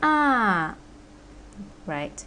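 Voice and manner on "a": long falling "a" from a high start; fourth tone. Shown as a demonstration. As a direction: slide "a" from high to down. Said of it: "from high to down".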